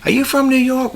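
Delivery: high-pitched imitated question; curious stranger. Repeats the strangers' usual question.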